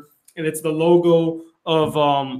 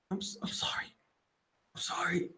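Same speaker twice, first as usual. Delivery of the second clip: faint, whispered imitation of last words; barely audible acted apology; dying whisper; 16 dB quieter, faster than usual. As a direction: whisper a halting apology as if fading away. Acting out Peter's dying apology.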